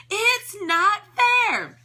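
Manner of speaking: high, very slow scream. A narrator's reading. Hem screams his complaint, high and very slow. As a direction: wail it out like a tantrum. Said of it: "he screamed at the top of his".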